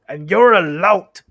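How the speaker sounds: very loud, slow jeering; troll insult. Acted. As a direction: fling the insult hard.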